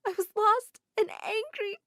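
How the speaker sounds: high, crying apology; tearful confession. Acted. Sister apologizing to Dad and Claire, high and crying. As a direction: let it spill out through tears. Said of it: "Hanny started crying".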